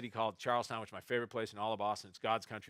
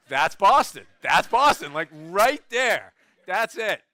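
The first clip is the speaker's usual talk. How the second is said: loud, high burst of delighted certainty; excited recognition; 8 semitones higher, 15 dB louder, slower than usual. Retold or imitated. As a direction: punch each short phrase hard, voice raised. Elated recognition of Boston's voice.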